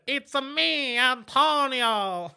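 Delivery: put-on high, drawn-out Mario-style Italian accent; mock Italian accent. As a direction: mimic a terrible Italian accent, stretching the name. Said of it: "you literally just said".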